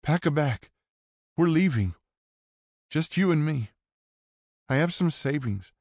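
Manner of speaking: put-on high, pleading teen girl; young woman voice. A narrator's reading.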